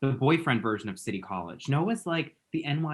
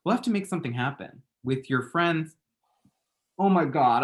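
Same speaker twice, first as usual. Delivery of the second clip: usual speaking voice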